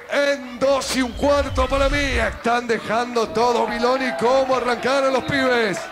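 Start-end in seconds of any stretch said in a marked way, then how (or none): none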